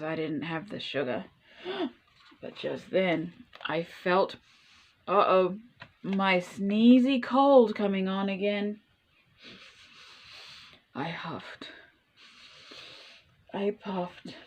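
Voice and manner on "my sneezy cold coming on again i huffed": plain reading voice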